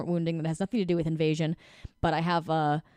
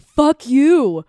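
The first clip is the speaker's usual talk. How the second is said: loud, high furious snap; a triggered yell, much louder; angry outburst; 8 semitones higher, 12 dB louder than usual. Shown as a demonstration. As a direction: lash out in a rage. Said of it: "When you're communicating with energy".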